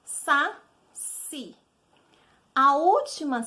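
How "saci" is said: "Saci" split into two drawn-out syllables, sa, si; teacher breaking "Saci" apart, sa... ci. Shown as a demonstration. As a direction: sound out "Saci" slowly, pausing before the final ci. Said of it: "Vamos ver, ó".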